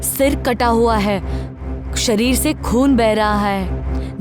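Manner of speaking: usual speaking voice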